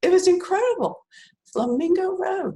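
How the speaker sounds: high and choked with real tears; tearful. Real, not acted. Author recalling her win, near tears. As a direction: voice lifting as emotion takes over. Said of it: "I'm crying right now".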